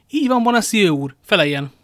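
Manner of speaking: high, loud demand for an answer; loud challenge. A narrator's reading. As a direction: confront him publicly. Loudly challenging merchant before guards.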